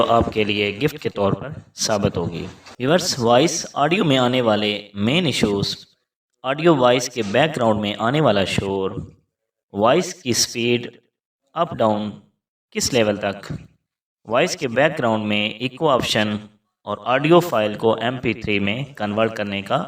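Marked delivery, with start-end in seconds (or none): none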